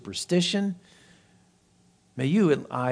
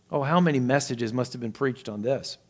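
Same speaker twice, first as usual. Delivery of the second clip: normal talking voice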